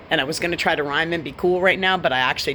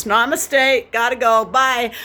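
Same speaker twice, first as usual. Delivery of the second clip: high, louder sing-song mock blessing; mock sweet namaste; 8 semitones higher, 6 dB louder, slower than usual. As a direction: chirp a fake spiritual greeting. Mock spiritual sign-off.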